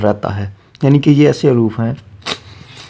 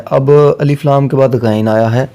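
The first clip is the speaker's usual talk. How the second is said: normal talking voice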